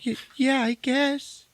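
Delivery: high, stammering panicked woman; hesitant falsetto; mock panic. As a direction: imitate her rattled, squeaky answer. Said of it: "she's fucking panicking".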